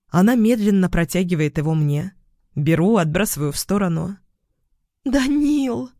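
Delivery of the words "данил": high, emotional cry of a name; overjoyed call. A narrator's reading. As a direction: call out to a lover, pitched up. Woman throws herself at him. (радостно, высоким голосом)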